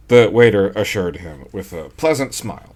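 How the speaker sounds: ordinary delivery, nothing marked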